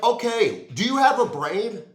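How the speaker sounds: loud and high, exasperated; yelling at lazy people; angry rant. Real, not acted.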